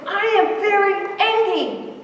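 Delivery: high, mock-furious little kid; angry child. Hungry-seeming child to preschool teacher, imitated angry insistence. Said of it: "get angrier and angrier and say".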